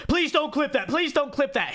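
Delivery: high-pitched mock pleading; squeaky, exaggerated panicky plea; mimicked panic. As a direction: imitate someone begging in a panic.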